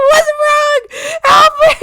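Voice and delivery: high, loud cries for help; mock-panicked cries. Streamer finds themselves cut in half in game, mock panic. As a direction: yelp in hammed-up alarm.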